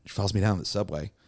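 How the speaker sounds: usual speaking voice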